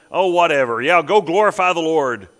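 loud, scoffing dismissal; mock the worshipper with exaggerated emphasis; sarcastic. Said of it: "That's just sarcasm".

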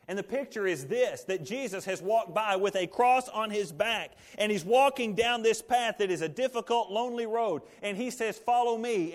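high, loud, driving sermon voice; fervent preaching. Acted. Preacher urging the congregation to choose the cross, full force.

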